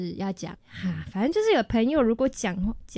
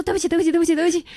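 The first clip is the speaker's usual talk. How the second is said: quick high flustered sorry; flustered apology; 8 semitones higher, 5 dB louder, much faster than usual. Retold or imitated. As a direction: blurt out apologies in a panic. Frantic apology after honking.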